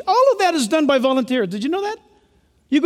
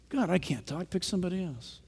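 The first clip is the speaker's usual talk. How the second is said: reluctant Moses; 6 semitones lower, 13 dB quieter than usual. Acted. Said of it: "We're just like Moses".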